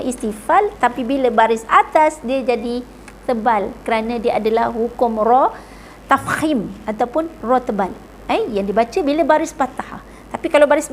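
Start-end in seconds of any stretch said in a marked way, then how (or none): none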